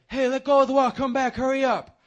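high, loud bark from the pool edge; lifeguard shouting. Retold or imitated. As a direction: yell at the kid to let go of the wall. Lifeguard yelling at swimmer.

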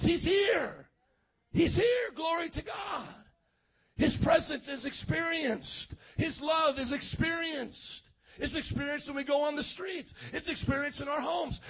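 high, ringing proclamation, then slow declarations; fervent revival preacher, stretching key words; impassioned preaching. Real, not acted. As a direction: proclaim it to the whole church. Preaches at full force.

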